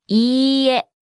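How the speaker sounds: いいえ with the long い stretched out; slow model. Shown as a demonstration. Teacher breaks down the word for no, slowly. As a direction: draw out いいえ slowly and evenly.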